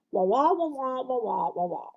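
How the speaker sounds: mock muted wah wah; Charlie Brown teacher. Mimicking what students hear, a wah wah drone. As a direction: imitate the unclear teacher drone. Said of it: "the teacher in Charlie Brown".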